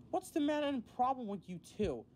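high, severe challenge. A narrator's reading. Boko confronts Percy and Toby, severely. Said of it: "Boko said severely".

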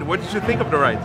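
ordinary talk

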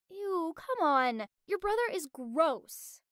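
high, slow, drawn-out revulsion; exaggerated disgust. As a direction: deny it with hammed-up disgust. Feigned disgust to deny crush.

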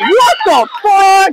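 slow, high, loud outburst; shocked. Real, not acted. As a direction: draw out the disbelief. Reacting to a bad roll.

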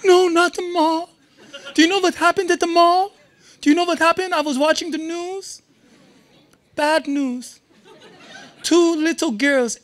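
a horrified father fussing over the mall; imitating dad. Scene: dad warns his son off the mall, panicked. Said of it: "I'm like".

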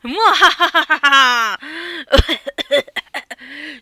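long loud hearty laugh. Acted. Long laugh after the call.

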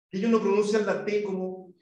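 ordinary talk, nothing marked

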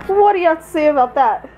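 ordinary talk, nothing marked

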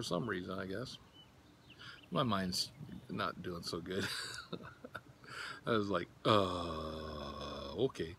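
talking around pipe stem; pipe in mouth. Real, not acted. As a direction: lips loosely gripping stem. Seen 1: Pipe stem held at lips while he talks and laughs.

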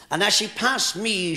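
usual speaking voice

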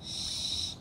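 sh, sh hissed without voice; sh sound hissed softly; whispered sh sound. Shown as a demonstration. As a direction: hiss the sh for the kids. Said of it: "when we say ship?".

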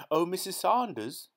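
high, loud excited call across the garden; excited shout. A narrator's reading. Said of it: "his voice shrill with excitement".

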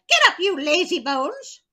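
high, loud yell; yelling witch. Acted. Witch waking the sleeping Gretel, high loud yell. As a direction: bark her awake. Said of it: "she yelled".

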